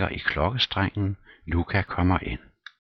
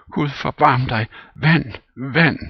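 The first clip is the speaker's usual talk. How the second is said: high, loud frantic cries; hammed-up old servant panic; panicked pleading; 10 semitones higher, 8 dB louder than usual. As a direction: beg for water in fright.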